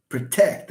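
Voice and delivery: "Protect" stressed on TECT; teacher's model pro-TECT for "Protect"; stress on second syllable. Shown as a demonstration. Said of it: "That's how you pronounce it".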